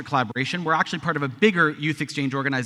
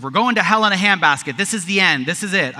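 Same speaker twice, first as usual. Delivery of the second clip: loud, raised mock panic; mock doom; 4 semitones higher, 7 dB louder than usual. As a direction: proclaim doom with hammed-up alarm. Voices doom reaction to news.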